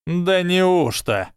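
high, slow, stretched-out words; loud drawl. A narrator's reading. Draco answers Harry's challenge, loud drawl. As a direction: drawl it out for all to hear. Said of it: "растягивать слова можно так громко".

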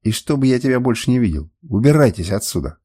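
ordinary delivery, nothing marked

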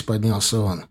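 usual speaking voice

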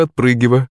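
ordinary delivery

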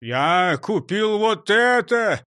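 proud, stretched-out reveal; joyful announcement. Acted. Driver shows off his purchase to the gang, gleeful. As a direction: announce it gleefully, drawing out each word. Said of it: "радостно доложил Крендель".

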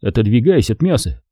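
ordinary delivery

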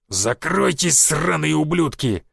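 slow growled command; growling. A narrator's reading. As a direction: snarl at the monsters. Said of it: "зарычал он".

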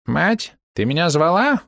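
high, slow squeak of a rat-man; squeaky rat voice. Acted. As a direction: squeak it out like a timid rat creature. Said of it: "Голос человека-крысы был писклявый".

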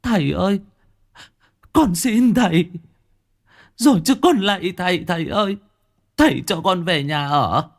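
high, loud wailing plea. A narrator's reading. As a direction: sob and beg your father, rising to a wail. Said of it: "tiếng khóc của cô Trinh mỗi lúc một lớn". (con gái khóc lóc van xin cha, gào to dần)

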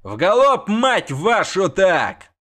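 high loud yell, slowed curse; high cursing yell. A narrator's reading. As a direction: swear them on at a gallop. Repeated yelling, cursing.

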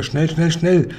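high, hurried urging; calling out. A narrator's reading. Giant hurries Sophiechen after catching a dream, calling out. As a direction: urge a helper on excitedly. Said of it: "rief er".

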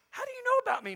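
high-pitched, puzzled question; startled question. Acted. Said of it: "Nathanael asked".

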